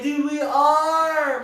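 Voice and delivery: loud, high, drawn-out retold reply; emphatic agreement. Telling friend they're going to hell, loud mock agreement. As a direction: stretch it out and belt it.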